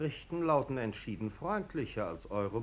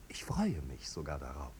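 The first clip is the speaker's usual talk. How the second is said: silky whisper; 10 semitones lower, 5 dB quieter than usual. Acted. Marquis sneers quietly.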